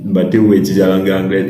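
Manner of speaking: brisk "Battez-vous à étudier la langue anglaise" in one run; fast, matter-of-fact repeat; quick firm recap. Shown as a demonstration. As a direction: rattle it off as a summary. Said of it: "Vous comprenez?".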